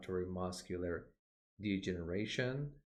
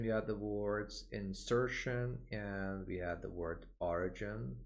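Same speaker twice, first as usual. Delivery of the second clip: ordinary delivery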